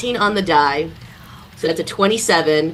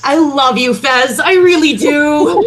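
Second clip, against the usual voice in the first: gushing; 8 semitones higher, 7 dB louder, slower than usual. Real, not acted. Player gushing over ally's help.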